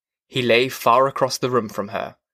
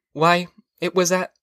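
higher wife's voice, left hanging; wife breaking off; 6 semitones higher than usual. A narrator's reading. Wife tries recalling first meeting, high voice breaking off. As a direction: start to answer, then stop short. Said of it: "she stopped".

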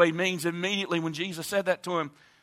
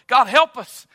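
desperate cry; 4 semitones higher, 10 dB louder than usual. Acted. Father crying out to God.

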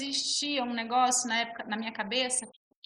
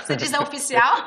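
upbeat producer; 3 semitones higher, 10 dB louder than usual. Retold or imitated. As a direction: announce it proudly to her. Voicing producer Marcela, announcing.

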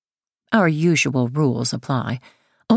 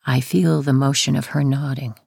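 plain reading voice

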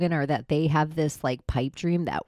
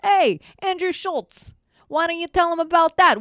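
high, loud taunting call; angry call-out; 10 semitones higher, 5 dB louder than usual. Real, not acted. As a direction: shout him out by name. Ranting call-out at Schultz.

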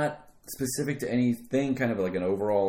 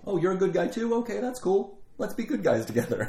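naive cheerful; 9 semitones higher than usual. Retold or imitated. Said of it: "kinda goes".

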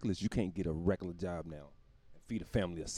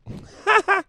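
very high, loud burst of laughter; 11 dB louder than usual. Real, not acted. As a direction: cackle loudly at your own joke. Laughs at own king bit.

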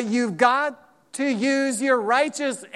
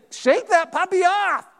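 high, slow playful command; a lightly voiced God telling you to shake it off; God answering a complainer, high and slow; 7 semitones higher, 4 dB louder than usual. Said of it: "He's gonna say".

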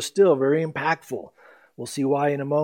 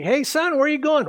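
higher-pitched caring mom calling to her son; mother's voice; 10 semitones higher, 4 dB louder than usual. Pretend. Pastor voicing the boy's mother asking where he goes, much higher pitch. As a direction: play the mom stopping her boy at the door.

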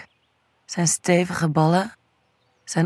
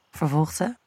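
ordinary delivery, nothing marked

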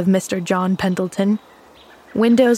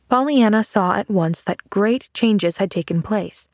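plain reading voice

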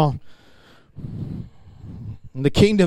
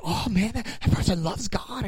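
high, soft mock awe; impressed admirer; 4 semitones higher, 8 dB quieter than usual. Onlooker admiring a worshiper, put-on awe. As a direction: marvel in a hushed, high voice. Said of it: "And I'm like".

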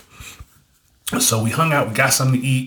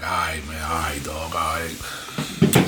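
low, soft, grudging mumble; subdued imitation of a friend conceding; reluctant agreement; 7 semitones lower, 6 dB quieter, slower than usual. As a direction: give in quietly, voice dropped. Friend reluctantly gives in, retold.

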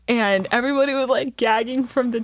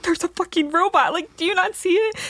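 paranoid insisting; 9 semitones higher than usual. Retold or imitated. Insisting there's a robot.

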